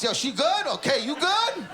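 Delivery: high, hammed-up confident challenge; brash confidence. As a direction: check on her like a cocky protector. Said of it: "You know that confidence?".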